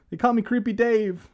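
high, loud put-on oddball voice; Creepy Dave. Prankster introducing himself, high put-on voice. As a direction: introduce yourself as a hammy weirdo.

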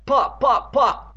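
high, punchy pops; bouncing noises. A narrator's reading. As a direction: punch out each pop like a bounce. Narrator imitates monster bouncing.

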